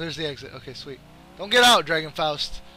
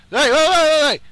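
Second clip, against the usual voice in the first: high, loud run of calls; urgent shout; 7 semitones higher, 7 dB louder than usual. Real, not acted. Gamer calling after his target, urgent shout. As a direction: call out to stop him.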